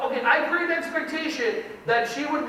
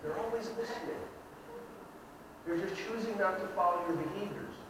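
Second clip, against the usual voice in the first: much softer, lower, quiet realization; hushed sincere point; 5 semitones lower, 12 dB quieter than usual. Real, not acted. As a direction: drop the voice after the yelling. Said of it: "soft point after the shouting".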